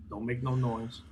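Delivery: soft, low-key hush; quiet warning. Pretend. Player telling a sleeping companion to stay silent, quietly. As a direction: urge silence under your breath. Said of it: "tell him very quietly".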